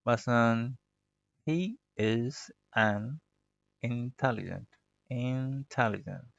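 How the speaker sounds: intelligent with the final t dropped, intelli-jen; end sound swallowed. Shown as a demonstration. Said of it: "एंड वाली जो sound है वो मैंने दबा दी". (intelligent का आख़िरी t दबा कर, ज़ोर शुरू पर)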